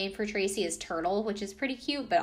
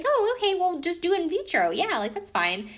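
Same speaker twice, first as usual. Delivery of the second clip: high, breezy "oh, okay"; mock blasé; 10 semitones higher, 4 dB louder than usual. Rich sister breezily suggesting in vitro, high mock-casual. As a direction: toss it off like money's nothing.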